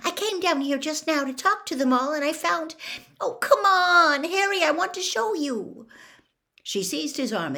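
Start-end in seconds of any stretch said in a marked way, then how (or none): 0.0-5.9 high, eager explanation turning to loud urging; urgent pleading. A narrator's reading. Hermione urging Harry to follow, high and impatient. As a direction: build from hurried explaining to an exasperated high plea.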